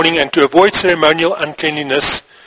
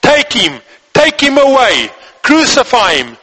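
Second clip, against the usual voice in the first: higher-pitched, forceful cries in narration; crowd shouting; 7 semitones higher than usual. A narrator's reading. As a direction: call out each demand as the crowd. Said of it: "But they shouted".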